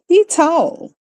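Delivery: said high, dropping \"is\" in \"He tall\". Shown as a demonstration. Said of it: "we say".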